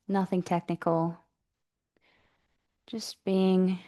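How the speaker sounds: calm, even meditation voice with long pauses; guided meditation. Real, not acted. Yoga teacher settling the class into breathing practice, guided meditation. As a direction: guide them gently into the breath, unhurried.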